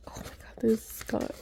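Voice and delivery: awed whisper; hushed amazement at the card. Real, not acted. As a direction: whisper in wonder.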